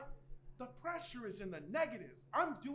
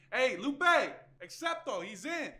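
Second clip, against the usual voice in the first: calling out; 2 semitones higher, 9 dB louder than usual. Acted. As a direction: holler it to get her attention. Calling out to Lupe.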